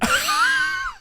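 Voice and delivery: sudden shrieking high laugh. Real, not acted. A shrieking high laugh at the podcast joke. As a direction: let out a screech of laughter. Burst out.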